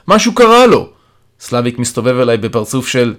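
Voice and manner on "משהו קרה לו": high, loud alarm; urgent call. A narrator's reading. Patient warns the carer about Stasha, urgent shouting. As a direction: shout it as a warning. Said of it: "אני קורא אליו".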